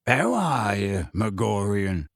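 slow, guarded greeting; wary gruff-giant greeting. A narrator's reading. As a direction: greet him cautiously, drawing out the words. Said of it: "said Hagrid warily".

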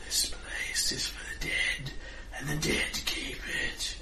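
slow hissed whisper from the dark; demonic whisper. Acted. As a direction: breathe it like a ghost at the ear. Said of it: "Sounds like he's whispering in your ear".

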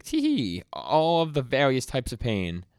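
high giggly villain voice, slowed; mock creepy villain, high and slow; creepy tee hee. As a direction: squeal it out like a sadistic creep. Villain voiced in anime recap.

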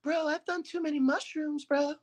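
mock stoned celebrity. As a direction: play her as high on mushrooms, laughing at the end. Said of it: "she's like".

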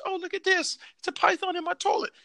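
very high, sing-song caricature; mock Australian local. Local finds a python in the toilet, mock Australian local. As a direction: pipe it up high, blithely amazed. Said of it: "they be just showing up".

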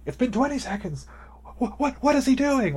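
mock-frantic climb from disbelief to high, loud outrage; announcers losing it. Chess fan imitating announcers counting the seconds, increasingly frantic. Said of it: "the announcers are losing their mind".

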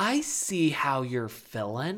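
slow, measured mock understanding; fake validation. Husband pretending to validate wife's feelings, slow fake sympathy. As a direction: stretch each word in put-on empathy. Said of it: "he gives this impression of, of validating her feelings".

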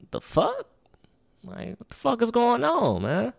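high, loud incredulous what the fuck; shocked disbelief. Retold or imitated. Telling friends how he reacted when the guard stopped him, high shocked disbelief. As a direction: voice jumps way up in disbelief.

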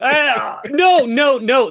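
loud mock protest; "no, no" shouted high; playful shout. Real, not acted. Protests the label.

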